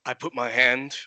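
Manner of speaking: ordinary delivery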